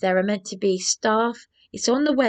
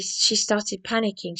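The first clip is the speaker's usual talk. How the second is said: ordinary talk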